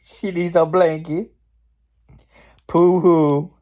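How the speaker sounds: high, loud fake baby pity; mock her with an exaggerated boo-hoo. Mocking the witch as a baby.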